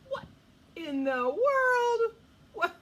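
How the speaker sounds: loud, high, drawn-out disbelief; astonished. Pretend. Storyteller finding a sheep up a tree, astonished. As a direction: stretch it out in hammed-up amazement.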